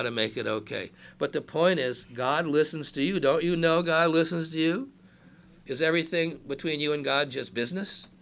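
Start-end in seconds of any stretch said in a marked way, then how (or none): none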